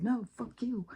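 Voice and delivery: high, soft cheeky dog voice; voicing the dog. The dog answering her call, a bratty high voice. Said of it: "And he is like".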